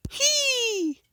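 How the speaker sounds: very high squeaky hee; high squeal. Pretend. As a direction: squeal like a cartoon character. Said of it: "And I go".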